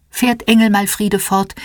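ordinary delivery, nothing marked

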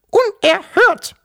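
very high, loud indignant squeak; tiny piping voice of protest; squeaky grandmother. A narrator's reading. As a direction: squeak it out slowly, outraged. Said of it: "piepste die Wawuschel-Großmutter".

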